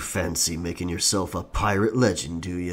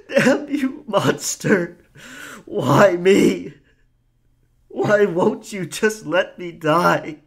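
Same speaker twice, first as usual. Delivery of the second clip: loud, higher raging despair, slowing at the end; anguished cry; 9 semitones higher, 6 dB louder, slower than usual. Acted. Pirate cursing the Kraken over his dead friend, anguished. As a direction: rail at the monster in grief.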